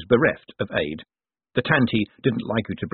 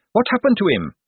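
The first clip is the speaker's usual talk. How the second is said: much higher, eager officer's voice; earnest watch captain; 11 semitones higher, 3 dB louder than usual. A narrator's reading. Carrot apologises to Moist in the fog, earnest. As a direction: pipe up, apologetic and dutiful.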